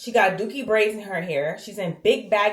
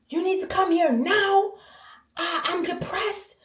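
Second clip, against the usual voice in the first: higher, upset crying mom on the phone; mother in distress; 9 semitones higher than usual. Retold or imitated. Daughter retelling her mother's crying call, imitating her upset.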